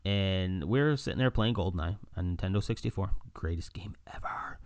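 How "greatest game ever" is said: unvoiced whisper; quick hushed aside about the game. Real, not acted. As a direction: whisper it like a secret.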